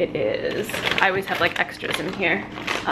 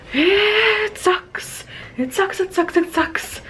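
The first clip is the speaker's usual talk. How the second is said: squeaky whine; 11 semitones higher, much slower than usual. Acted. Complaining about dry weather.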